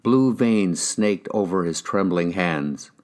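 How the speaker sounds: ordinary delivery, nothing marked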